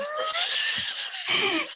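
helpless high cackle of laughter; high shrieking laugh. Real, not acted. Burst of laughter.

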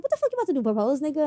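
high-pitched retold disbelief; incredulous. Girlfriend retelling her question just before the proposal, incredulous.

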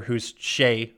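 usual speaking voice

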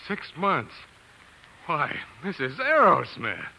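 high, loud, delighted; thrilled. Acted. Husband hears of the baby, high thrilled whoop. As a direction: whoop with joy.

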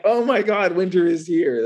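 high-pitched, wowed outburst; excited mock exclamation. Reacting to the message on a frozen whiskey bottle, excited mock exclamation. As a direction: play up the amazement, pitched well up.

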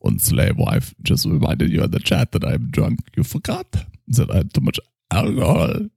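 put-on tipsy German doctor accent; lightly drunk German-accented psychoanalyst. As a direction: play a drunk Viennese shrink.

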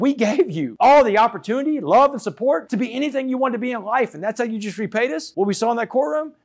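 high-pitched scolding as mom; imitating an indignant mother's rebuke; retold motherly reproach. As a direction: demand an answer, pitch well up. Retold mother scolding her sentenced son.

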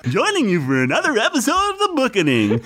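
high, swooping silly host voice; sing-song, exaggerated high caricature; mock Nathan impression. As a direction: ham up the high-pitched show intro. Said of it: "why I'm doing this voice".

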